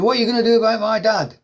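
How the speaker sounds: angry Welsh son on the phone, loud and high; broad Welsh accent. Retold or imitated. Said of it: "on a really broad Welsh accent".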